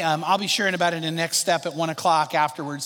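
ordinary delivery

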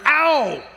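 sharp loud pained "Ow!"; retold yelp of pain. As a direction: yelp it like the bite just landed. Wife bitten by nursing baby.

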